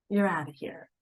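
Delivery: breathy whisper of a sudden ending. Acted. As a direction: let it go out in a whisper. Death arrives without warning.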